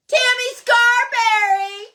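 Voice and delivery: high, loud, drawn-out yell of the name. Real, not acted. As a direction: belt the winner's name out. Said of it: "I'm yelling tonight".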